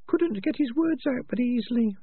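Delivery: very high, thin rat voice; tiny high-pitched rat, pointed; prim female rat. Acted. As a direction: squeak it out as a small rat. Peaches the rat's put-on voice.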